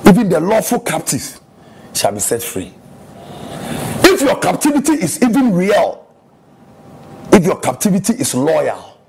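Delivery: fervent sermon to the church; preaching. Acted. Pastor preaching to his church, fervent. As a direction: preach it out to the congregation.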